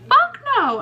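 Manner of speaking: high, loud shocked refusal; outraged refusal. Retold or imitated. To the blackmailer, a high loud outraged refusal. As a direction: fire it back, high and loud.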